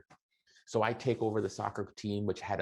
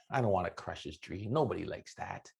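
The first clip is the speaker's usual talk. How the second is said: usual speaking voice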